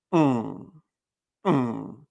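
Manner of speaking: open-mouth hum ringing in the nose; nasal ng hum. Shown as a demonstration. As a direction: hum low through the nose. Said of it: "Fíjate".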